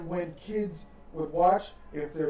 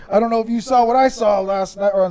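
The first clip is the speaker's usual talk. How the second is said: loud, earnest mock-kid report, building higher; kid telling teacher; 4 semitones higher, 11 dB louder than usual. Kid reporting wrestling news to teacher, loud and urgent. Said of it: "go back to school and tell his teacher".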